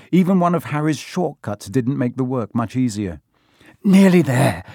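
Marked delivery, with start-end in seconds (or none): 3.8-4.6 louder, higher, strained gasp; panting. A narrator's reading. Harry hauling the crate upstairs, panting. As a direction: push it out while heaving a load. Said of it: "Harry panted".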